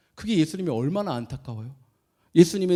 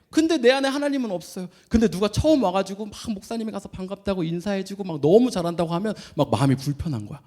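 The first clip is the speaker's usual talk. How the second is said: ordinary talk, nothing marked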